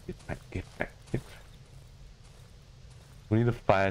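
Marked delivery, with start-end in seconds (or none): 0.1-1.5 breathy repeated whisper of get pranked; whispered taunt. Pretend. Gamer whispering a taunt at the fooled enemy. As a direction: hiss the taunt under your breath.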